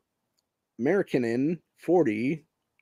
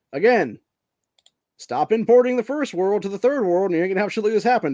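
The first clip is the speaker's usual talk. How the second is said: raised, louder voice, fast at the end; heated rant; 6 semitones higher, 4 dB louder than usual. Real, not acted. Ranting vlogger to viewers, raised and heated. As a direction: push it out like a fed-up lecture. Ranting about fraud charges.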